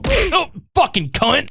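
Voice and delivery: high, slow, strained mock groan; imitated falling man. Imitating the sheriff hit by a shovel, high pained curse. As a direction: mimic a man cursing as he drops. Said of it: "You just hear him go".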